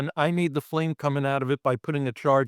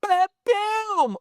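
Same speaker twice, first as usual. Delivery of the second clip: mock explosion sound. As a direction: blast it out like the blood hitting the wall. Imitating the blood blast.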